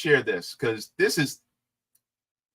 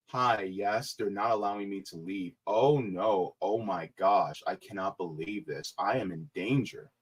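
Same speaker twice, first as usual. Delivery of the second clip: mock helpless caller; 9 semitones lower, 9 dB quieter than usual. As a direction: lower and much softer, faking distress. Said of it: "She's just like".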